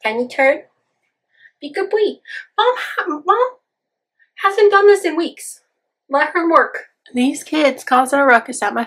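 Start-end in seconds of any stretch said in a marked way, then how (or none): 0.0-6.9 pet voice. Real, not acted. Baby talk to the cats.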